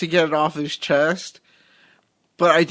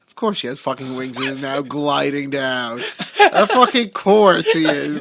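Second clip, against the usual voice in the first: sarcastic laughing; 4 semitones lower than usual. Retold or imitated. Mocking the wings reveal, low laughing sarcasm. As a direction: roll your eyes through a laugh. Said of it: "I was like".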